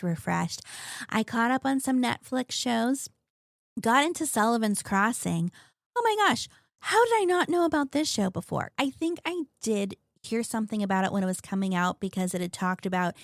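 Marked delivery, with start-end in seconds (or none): 6.0-8.7 excited. Real, not acted. Excited about new show.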